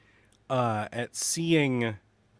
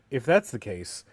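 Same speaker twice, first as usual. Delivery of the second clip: normal talking voice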